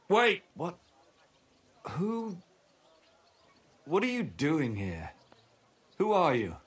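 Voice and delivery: high, alarmed questioning; startled. Acted. Hungover man suddenly noticing a stranger, startled and demanding.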